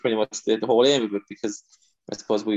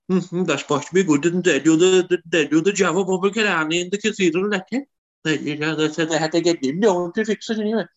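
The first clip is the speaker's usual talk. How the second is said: put-on a touch higher, still the old neighbour; old neighbour winding down his news; lightly voiced Kerry neighbour; 6 semitones higher than usual. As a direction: ease off but keep the neighbour's lilt. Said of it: "that kind of crack, that turn of phrase".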